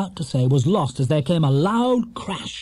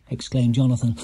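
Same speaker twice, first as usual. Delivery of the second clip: ordinary delivery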